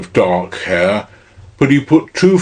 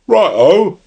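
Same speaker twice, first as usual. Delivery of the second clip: cockney voice; 9 semitones higher than usual. A narrator's reading. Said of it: "a clear, commercial and somewhat cockney voice".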